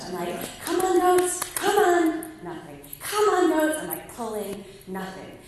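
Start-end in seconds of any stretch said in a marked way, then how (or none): 0.6-2.3 high, loud coaxing call; calling to goats. Retold or imitated. Calling stubborn goats, reenacted. 3.0-3.8 high, loud coaxing call; calling to goats. Retold or imitated. Calling stubborn goats, reenacted.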